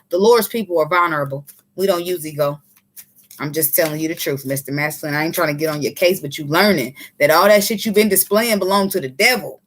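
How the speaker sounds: ordinary talk, nothing marked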